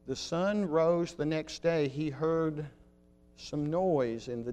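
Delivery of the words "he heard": ordinary talk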